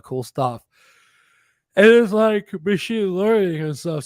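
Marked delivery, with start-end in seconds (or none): 1.8-4.0 talking through a yawn; words stretched by a yawn. Real, not acted. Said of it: "Sorry for my, all my yawning".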